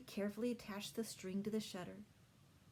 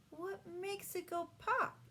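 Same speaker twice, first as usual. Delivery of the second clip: put-on curious little girl; 12 semitones higher, slower than usual. A narrator's reading. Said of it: "Sue asked".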